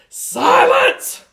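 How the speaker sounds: huge high furious shout; scary yell. A narrator's reading. Grandma bursting in on the peeping girls: scary shout. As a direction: bellow it to freeze the room. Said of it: "she yelled, real scary".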